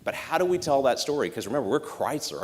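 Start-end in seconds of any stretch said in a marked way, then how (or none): none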